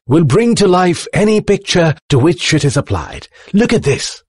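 high-pitched thrilled inventor; with excitement. A narrator's reading. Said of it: "said the Professor with excitement".

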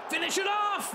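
high, excited yell; urging shout. Real, not acted. Gamer urging his striker through on goal, excited. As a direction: urge him to finish.

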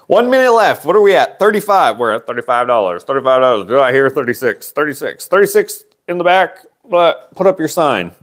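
high, lively auction calling; auctioneer voice. Pretend. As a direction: call the bids like an auctioneer. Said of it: "uh, auctioneer voice".

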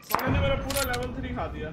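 annoyed outburst. Real, not acted. Complains about broken armor.

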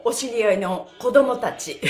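ordinary talk, nothing marked

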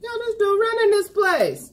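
surprised. Retold or imitated. Quoting her own reaction.

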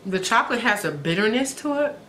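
covering mouth while chewing; talking while chewing. Real, not acted. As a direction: mouth partly full. Seen 0: bites macaron at -12; hand covers chewing mouth at 0.8.

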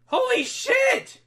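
shrieking disbelief. Real, not acted. Streamer to chat after yet another shiny, slow stunned shriek. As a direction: scream it out slowly in shock.

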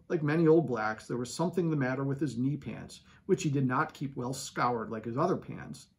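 plain reading voice

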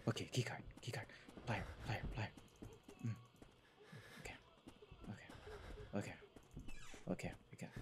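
whispered list under breath; whispering. Real, not acted. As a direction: whisper the items to yourself. Sneaking, listing items.

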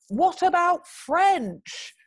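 very high, drawn-out asking; exaggerated slow high suggestion; slow hopeful question. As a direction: stretch each word for a foreigner, pitched high.

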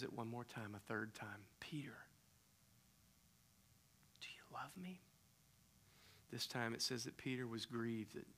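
soft, near-whispered tender questions; hushed tender exchange. A narrator's reading. Jesus quietly asking Peter about love, soft and tender. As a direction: hush the room, ask gently.

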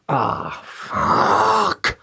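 whispered, exasperated curse; hushed groan of dismay; retold dread. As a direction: breathe the curse out under your breath. Sees neighbor approaching, retold dread.

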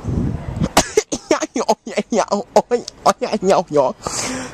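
spluttering laugh after the smoke; coughing laugh. Real, not acted. Uncle coughing after his first shisha. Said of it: "I'm just clearing my throat".

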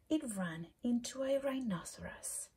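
plain reading voice

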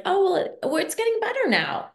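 hopeful parent. Retold or imitated.

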